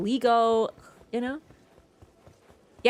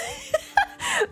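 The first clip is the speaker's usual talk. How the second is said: high giggle. Real, not acted. Laughing at own joke.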